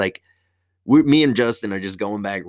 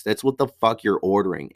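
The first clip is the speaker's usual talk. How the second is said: ordinary talk, nothing marked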